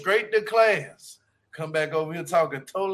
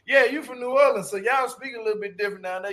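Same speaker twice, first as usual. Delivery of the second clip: ordinary delivery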